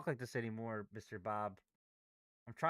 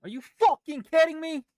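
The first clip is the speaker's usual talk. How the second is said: furious yell at the screen; angry shout; 10 semitones higher, 9 dB louder than usual. Real, not acted. Trader yelling at the market moving against him, furious shout. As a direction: blow up at the chart, loud and high.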